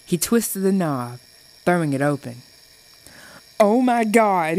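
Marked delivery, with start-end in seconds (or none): 3.6-4.6 squeaking. A narrator's reading. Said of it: "he squeaked".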